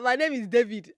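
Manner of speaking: put-on "My name is David" in a Nigerian accent, loud and high. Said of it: "I do it with a Nigerian accent".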